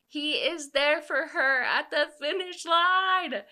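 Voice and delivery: high, loud, drawn-out swoon over the big moment; thrilled fangirl outburst, loud and high; gushing excitement. Real, not acted. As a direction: squeal it slowly, stretching each word.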